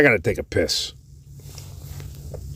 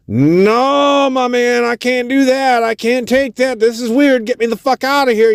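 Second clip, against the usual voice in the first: much higher, alarmed refusal; panicking man. Retold or imitated. Homeless man refusing the band's cash, panicking. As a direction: back away from the money in alarm. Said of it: "He started panicking".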